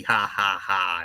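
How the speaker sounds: slow, separated mock laugh; Jesse. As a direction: spell out each fake ha. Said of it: "full on Jesse".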